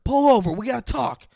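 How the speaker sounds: mock yell. Acted stalker yelling at her.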